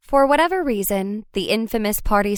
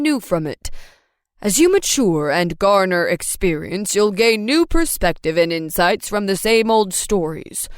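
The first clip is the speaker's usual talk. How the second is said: dad voice. A narrator's reading. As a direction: counsel like a wise father.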